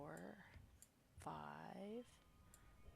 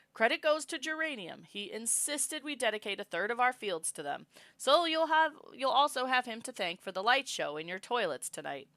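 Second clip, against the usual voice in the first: higher, louder public address; mom's announcement; 6 semitones higher, 6 dB louder than usual. Acted. Mom addressing the festival crowd, in a higher announcing voice.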